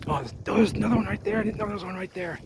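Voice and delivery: high, fast and eager; excited discovery. Real, not acted. Explorer spotting a hidden canal wall, excited high and fast. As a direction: blurt it out, thrilled at the find.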